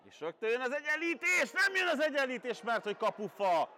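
high, urgent goal call; excited hungarian sports commentary. Acted. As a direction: shout it up as the ball flies. Near equaliser hits the post.

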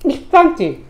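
chewing while speaking; words muffled by food; talking with mouth full. Real, not acted. Seen 2: Chewing with bulging cheeks while speaking; fish-like food on platter.

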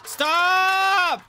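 high, loud cry of Stop; panicked shout. Real, not acted. Panicking at the horror game, a high shout. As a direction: yell at the game to make it stop.

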